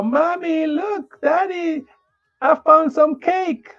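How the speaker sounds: put-on high, excited child finding something; high kid voice, slow on the cake; little child voice. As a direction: play a small kid thrilled with a find. Voicing small child.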